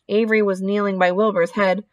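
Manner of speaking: usual speaking voice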